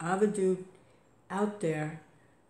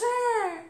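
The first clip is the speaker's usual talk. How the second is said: high squeaky mock cat yowl, much louder; cat's cry imitated; 6 dB louder than usual. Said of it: "she goes".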